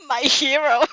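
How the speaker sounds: loud, laughing cry of delight. Acted. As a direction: burst out with it, giggling. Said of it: "You couldn't help but cry out".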